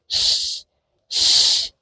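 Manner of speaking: "ش" as a voiceless rush of air, tongue near the palate; isolated soft airy "ش"; airy ich sound. Shown as a demonstration. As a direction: hiss "ش" like German ch in ich. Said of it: "هي بتطلع صوت كده بيبقى طالع منه هوا".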